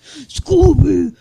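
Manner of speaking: loud, drawn-out monster roar; put-on monster voice, stretched out; monster Scrappy-Doo. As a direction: bellow it slowly like a hulking cartoon monster. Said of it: "he gets, like, a deep voice".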